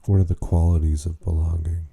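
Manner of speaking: usual speaking voice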